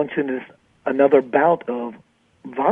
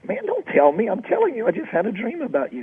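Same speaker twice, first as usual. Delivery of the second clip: high-pitched, retold insistence; insistent protest; 8 semitones higher than usual. Dreamer insisting to his caller he really dreamed it, high protest. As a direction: protest in a much higher voice, as if disbelieved.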